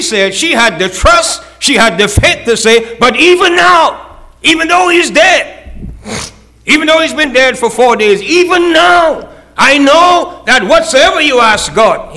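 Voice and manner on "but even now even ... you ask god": cling to hope; fervent Martha. Acted. Martha's faith proclaimed.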